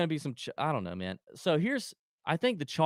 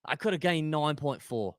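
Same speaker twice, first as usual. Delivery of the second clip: put-on a light Aussie accent on a griping golfer; touch of an Australian accent complaining; 5 dB louder than usual. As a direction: play a whining golfer in a mild Aussie accent. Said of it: "Now you sound a little Australian".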